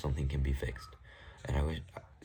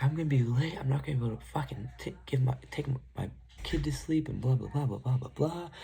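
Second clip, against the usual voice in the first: high, whiny mock rant of the girlfriend; mocking her rant; 9 semitones higher than usual. Girlfriend blowing up over being late, high mock rant. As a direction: parody her complaining in a shrill high pitch. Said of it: "she just blows up on me".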